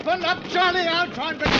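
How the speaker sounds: high, loud yells; shouting. Acted. Men shout to stop the charging lion. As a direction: yell over the gunfire.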